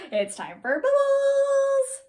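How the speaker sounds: high, loud, long-held final word; excited announcement. Real, not acted. Announcing bubbles to the children.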